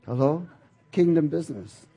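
ordinary talk, nothing marked

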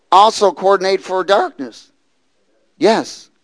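ordinary talk, nothing marked

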